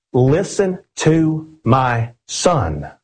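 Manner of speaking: very slow, each word weighted; slow and emphatic, every word landing; forceful command. Shown as a demonstration. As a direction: lay down the order with authority. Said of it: "He's showing forcefulness".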